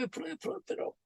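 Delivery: soft unvoiced whisper of the count. Shown as a demonstration. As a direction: let the count fade to a whisper. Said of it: "stretching instructions".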